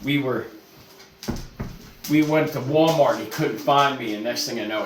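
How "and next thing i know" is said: normal talking voice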